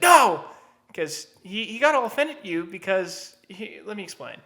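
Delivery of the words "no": sharp shouted no, higher and louder. Retold or imitated. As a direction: bark a flat refusal. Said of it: "Gabe's like".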